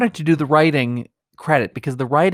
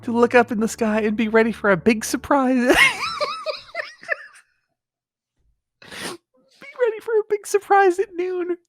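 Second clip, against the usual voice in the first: much higher, laughing shout; losing it mid-read, barely getting words out; laughing through the words. Real, not acted. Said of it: "completely broken".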